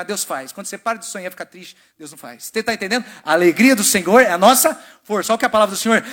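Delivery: normal talking voice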